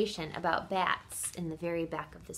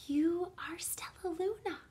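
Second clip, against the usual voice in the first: soft, wondering whisper; 9 semitones higher, 6 dB quieter than usual. A narrator's reading. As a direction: breathe it out in hushed recognition. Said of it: "she whispered".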